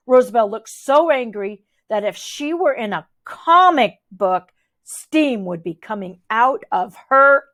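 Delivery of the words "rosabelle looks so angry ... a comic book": ordinary delivery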